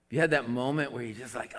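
ordinary talk, nothing marked

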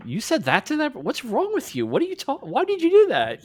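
high-pitched, disbelieving mock rebuke; incredulous self-scolding. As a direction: question yourself in high, swooping disbelief. Acted self-scolding over sent messages.

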